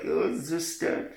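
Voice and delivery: slow, hushed mock-French voice. Shown as a demonstration. Trying a voice for Marius, hushed tentative French accent. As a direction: try out French, soft and drawn out. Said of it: "What's a French voice like?".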